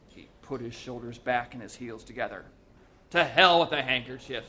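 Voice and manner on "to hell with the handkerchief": loud, defiant scorn; ringing dismissal; scornful. A narrator's reading. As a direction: refuse the blindfold with heroic contempt. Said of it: "said Walter Mitty scornfully".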